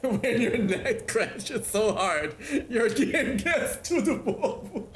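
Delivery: words stretched out by laughter; laughing. Real, not acted. Streamer reacting to a game crash, laughing. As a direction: speak through real laughter, slowing down.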